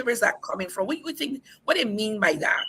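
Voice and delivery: normal talking voice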